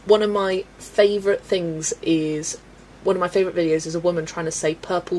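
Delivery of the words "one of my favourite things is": ordinary talk, nothing marked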